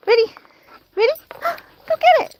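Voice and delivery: gasping, very high, stretched-out "go get it"; breathless squeal as the ball flies; excited launch. Real, not acted. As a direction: build suspense then release. Throwing ball with gasp.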